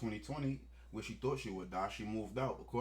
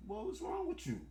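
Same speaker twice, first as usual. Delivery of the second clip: squeaky mock voice, much higher. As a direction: pitch it way up in a mocking put-on voice. Mocking outburst at the accused.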